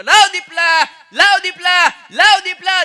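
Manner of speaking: high, very loud yell for Dipla; old man hollering for his boy in a panic; high, very loud calling out to Dipla; mock frantic shout. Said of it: "રાડ પાડવા માંડ્યા".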